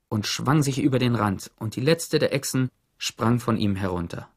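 ordinary delivery

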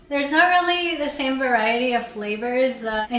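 chewing between words; talking with food in mouth; mouth full while talking. Real, not acted. Seen 1: Bites at -2, chews visibly until about 3.1s, then talks plainly.

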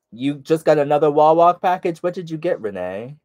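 ordinary talk

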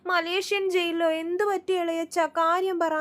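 tearful plea. Retold or imitated. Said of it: "അപ്പൊ തന്നെ ശ്രുതി കരയാൻ തുടങ്ങിയേ".

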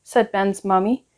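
ordinary delivery, nothing marked

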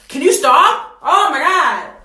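annoyed yell at camera. Real, not acted. Camera keeps turning off.

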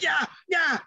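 high, eager imitated calls; calling for puck. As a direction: mimic hollering to a teammate from the slot.

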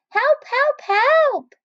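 high, frantic little voices; panicked cry for help. Acted. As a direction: squeal for help like tiny ants. Ants panicking in the rain.

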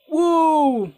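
high, long falling woo; loud startled whoop. Real, not acted. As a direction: let out a big whoop. Scare in horror game. (malakas na hiyaw)

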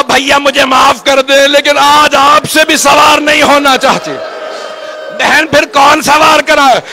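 preacher building the Karbala farewell to a crying peak; rising lament recitation. Acted. Mourning preacher voicing Zainab's farewell, rising into a wailing cry.

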